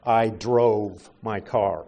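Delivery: spelled out slowly as a teaching example; slow example sentence. Shown as a demonstration. Preacher slowly reads an example sentence to the congregation. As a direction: lay out each word slowly for the class.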